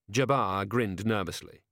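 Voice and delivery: usual speaking voice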